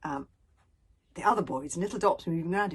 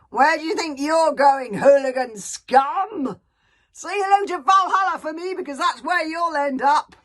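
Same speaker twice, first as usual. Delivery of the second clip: higher, loud mocking yells; jeering shout; 9 semitones higher, 10 dB louder than usual. A narrator's reading. Hysterics jeering at fleeing Hiccup, shouting. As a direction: taunt them loudly. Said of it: "shouting out jeeringly".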